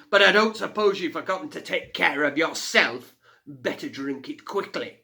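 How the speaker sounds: mocking fire fiend. A narrator's reading. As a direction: sneer down at the tiny girl.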